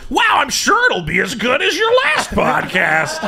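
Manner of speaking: hammed-up fawning enthusiasm; mock Asterios. Host mimics the sidekick praising a new podcast, mock gushing. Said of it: "and Asterios goes".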